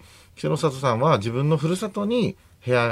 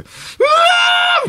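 very high, loud, drawn-out "waaah"; retold scream of excitement, shrieked at full volume; excited group scream; 8 dB louder, much slower than usual. Man retelling his surprise visit to idol group, excited high scream. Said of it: "みたいになって".

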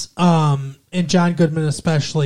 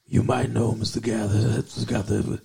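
Sam Elliott impression; 7 semitones lower, 5 dB quieter than usual. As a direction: rumble it low like an old Texas cowboy. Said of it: "kind of deep voice".

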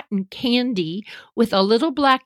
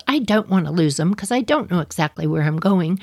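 ordinary talk, nothing marked

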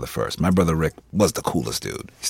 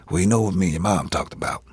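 normal talking voice

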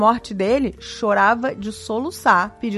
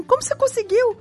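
high, wide-eyed amazement; amazed grandmother; 12 semitones higher than usual. Retold or imitated. Grandma, awestruck, asks her groom with high amazement. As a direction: ask it in disbelief at the luxury. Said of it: "olhava pro meu avô e falava assim".

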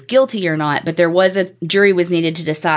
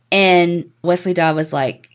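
normal talking voice

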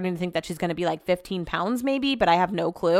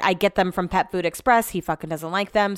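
usual speaking voice

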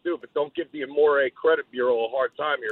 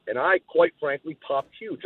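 ordinary delivery

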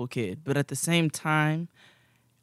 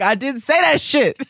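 heated denial; 10 semitones higher, 5 dB louder than usual. Retold or imitated.

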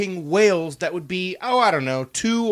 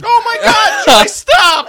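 laughing protest; 8 dB louder, slower than usual. Real, not acted. Protesting the joke.

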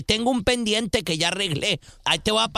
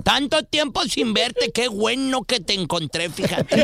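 croaky put-on old man on the phone; a lightly voiced old grandpa pretending to be her brother; 4 semitones higher than usual. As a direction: play a little old man calling his sister. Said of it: "como voz de viejito".

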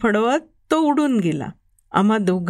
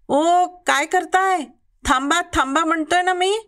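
shouting; 5 semitones higher than usual. A narrator's reading. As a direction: yell at the workers to stop. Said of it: "Sanju मामा ओरडला". (झाडं तोडणाऱ्यांवर ओरडणारा संजू मामा, उंच आवाजात)

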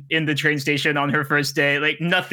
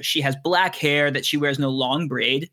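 normal talking voice

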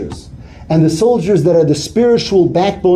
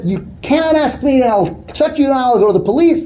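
lashing out; 7 semitones higher than usual. Retold or imitated. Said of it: "he really lashed out at them".

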